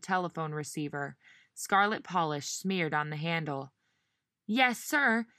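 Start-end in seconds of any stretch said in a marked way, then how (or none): 4.5-5.3 much higher, piercing yes sir; shrill reply. Acted. Receptionist answering her boss on the phone, shrill. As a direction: pitch it up sharp and eager. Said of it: "she shrilled into the phone".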